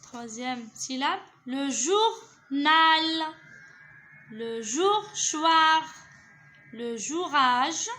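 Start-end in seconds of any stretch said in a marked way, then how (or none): 1.4-3.1 "journal" very slow and loud, split as jour, nal; syllable by syllable. Shown as a demonstration. Teacher testing syllable combinations, "journal" slowly sounded out in pieces. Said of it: "Je relis les syllabes". 4.3-7.9 "jour-choir" and "jourage" slow and projected, syllables held; joining syllables. Shown as a demonstration. Teacher testing syllable combinations, "jour-choir" slowly sounded out. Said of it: "Je relis les syllabes".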